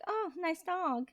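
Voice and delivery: high, drawn-out passing acknowledgement; mock disregard voice. Passer-by walking past an adorable dog, mock disregard. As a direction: brush the dog off in a high, slow put-on voice. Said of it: "doing that voice for the disregard voice".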